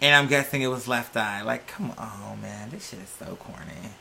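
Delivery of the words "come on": usual speaking voice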